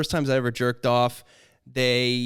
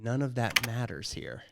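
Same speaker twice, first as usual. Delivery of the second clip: quiet slow realization; 2 semitones lower, 8 dB quieter than usual. Retold or imitated. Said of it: "looking around like".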